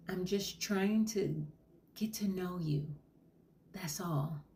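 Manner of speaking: slow, hushed reassurance; gentle therapist whisper; soothing whisper. Acted. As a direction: ease off into a whisper to calm her.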